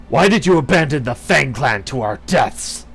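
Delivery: angry accusation. Acted. Accusing the queen of abandonment.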